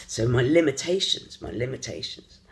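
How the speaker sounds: ordinary delivery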